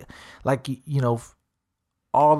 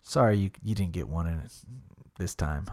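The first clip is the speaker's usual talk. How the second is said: lower, softened retold apology; subdued apology; 6 semitones lower, 6 dB quieter than usual. Dad sorry his son missed out, low and subdued. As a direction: gently imitate a regretful dad. Retells dad's sincere apology.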